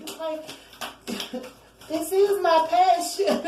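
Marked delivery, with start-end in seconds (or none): 1.9-3.4 high, drawn-out comic declaration; hammed-up passion, pitched way up; mock passion. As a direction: squeal it slowly as a joke. Acting querent's comic answer, laughing.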